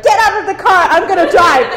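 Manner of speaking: impatient dad. Retold or imitated. Impatient dad ordering his daughter out of the car. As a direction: bark it like a fed-up dad. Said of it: "he would be like".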